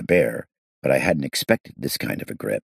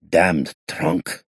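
choked voice. Acted. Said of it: "he said in a choked voice".

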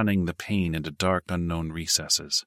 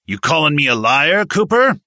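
much higher, louder, rising shout; angry challenge; 9 semitones higher, 8 dB louder than usual. A narrator's reading. Sergeant angrily challenging Cooper, loud shout. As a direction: get right in his face with it.

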